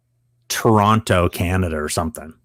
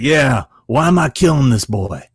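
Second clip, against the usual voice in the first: raised, louder actor impression; imitated in-character actor, pitched up; Robert Patrick; 5 semitones higher, 4 dB louder than usual. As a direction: challenge the writer as a demanding actor. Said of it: "And he's like".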